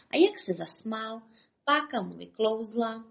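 ordinary delivery, nothing marked

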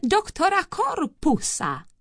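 high, drawn-out ceremonial announcement; pompous herald's delivery; grand proclamation. A narrator's reading.

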